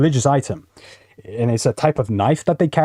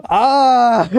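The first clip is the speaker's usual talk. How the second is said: high pained cry, hammed up; mock anguished cry; mock wail; 12 semitones higher, 5 dB louder than usual. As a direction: wail high like the stabbed man. Mock cry of stabbed victim.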